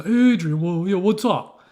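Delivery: loud, high, drawn-out boxer's holler; Rocky imitation. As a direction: holler like a kid playing the movie boxer. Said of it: "now you want to see rock".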